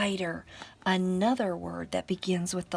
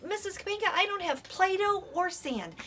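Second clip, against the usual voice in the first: higher voice of a complaining pupil; lightly mimicked pupil, pitched up; pupil's objection; 12 semitones higher, 5 dB louder than usual. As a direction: put on a pupil raising a problem to teacher.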